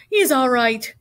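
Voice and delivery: loud, insistent cry; cried out. A narrator's reading. Zuckerman defending his pig to the loudspeaker, loud cry. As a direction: call it out over the crowd. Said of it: "cried Mister Zuckerman".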